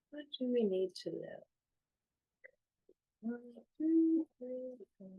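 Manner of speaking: very soft, half-voiced murmur; murmuring to oneself. Real, not acted. Reader counting out cards for the guidance, absorbed murmur. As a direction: think aloud quietly while counting.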